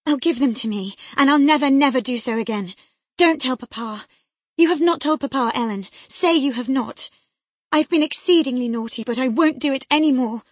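high, loud tearful pleading; frantic begging. A narrator's reading. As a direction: beg desperately not to be told on. Girl begging nurse, frantic.

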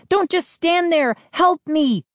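high, urgent cry for help; calling for help. A narrator's reading. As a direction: call out high and desperate. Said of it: "Mindy called back".

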